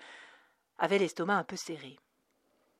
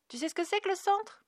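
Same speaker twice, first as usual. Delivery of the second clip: a lightly voiced little girl, much higher; 14 semitones higher than usual. A narrator's reading. Little Cerise asking the educator, lightly voiced child. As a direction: ask like a small curious child.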